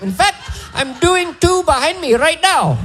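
put-on high Indian accent, cheerfully making surgery noises; gleeful Indian doctor. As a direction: sell the bypass like a fun game, Indian accent. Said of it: "describes bypass surgery like it's fun".

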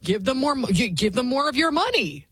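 lightly hammed-up eager agreement; mocking imitation. Host mocks the woman who agreed to pay, mock-eager. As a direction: mimic her stumbling, over-keen yeses. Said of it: "She was like".

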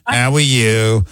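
mock New York accent, drawn out slow; exaggerated New Yorker's greeting, stretched out. Host contrasting Utah with New York, mock New York accent. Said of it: "if it was from New York, it'd be".

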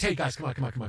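a hint of [name], low and soft; [name] quote. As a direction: wave your buddies off, relaxed. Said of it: "And [name] says".

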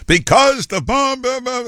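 a clumsy stab at Curtis's oratory; imitating Curtis. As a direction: parrot his testifying cadence, badly. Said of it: "it doesn't sound right when I do it".

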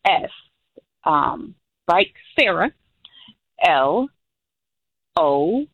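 spelled out slowly with long pauses; real slow. Shown as a demonstration. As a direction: dictate it piece by piece for someone writing it down. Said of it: "say it real slow as if someone's writing it down".

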